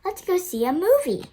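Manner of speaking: put-on a boy jumping in with his idea; eager kid voice. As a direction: blurt it out like an excited boy. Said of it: "Robert says".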